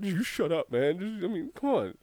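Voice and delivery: ordinary delivery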